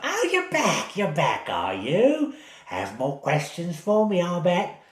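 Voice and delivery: put-on high old lady voice, amused; knowing old woman. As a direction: greet them like a granny who saw it coming.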